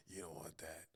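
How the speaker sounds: soft, low gruff mutter; gruff Sean Penn voice. Retold or imitated. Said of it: "he always talks with the gruffness".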